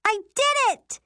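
high, loud triumphant squeal; excited squeal. A narrator's reading. Sister whose spell worked, squealing. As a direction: squeal with delight at your success. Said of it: "she squeals".

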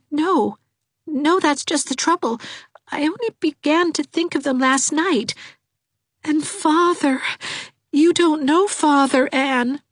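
put-on weepy young girl; tearful Dovey. A narrator's reading. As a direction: wail her misery.

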